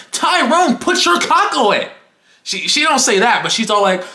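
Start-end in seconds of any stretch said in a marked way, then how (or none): 0.1-1.9 exasperated teacher. Retold or imitated. Comic exaggeration of fed-up teacher.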